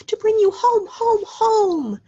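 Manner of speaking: put-on high, loud, slow child voice; little girl. A narrator's reading. Little Fan comes to fetch her brother home, high excited child voice. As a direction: play an overjoyed little sister.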